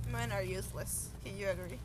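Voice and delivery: ordinary delivery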